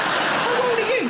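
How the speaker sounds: high, slow, drawn-out shouted question; hammed-up high-pitched yell; mock shout. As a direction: call it out in exaggerated disbelief. Shouted challenge in acted exchange.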